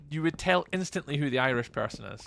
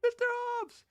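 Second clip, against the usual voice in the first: excited greeting; 3 dB quieter than usual. Real, not acted. Greeting a chat member.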